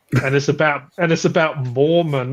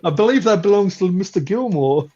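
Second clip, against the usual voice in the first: lightly put-on, higher film-character voice; movie quote; 5 semitones higher, 4 dB louder than usual. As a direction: quote the comedy line in a mock character voice. Quoting a line from Happy Gilmore.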